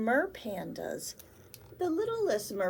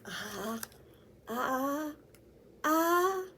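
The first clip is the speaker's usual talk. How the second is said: ah ah ah climbing higher; rising sneeze try; 4 semitones higher, much slower than usual. Acted. Panda cub trying to sneeze, rising higher.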